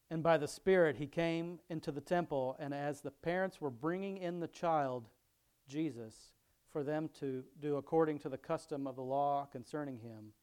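scripture reading. Acted. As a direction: read the passage out to the congregation.

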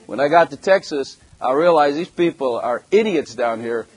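normal talking voice

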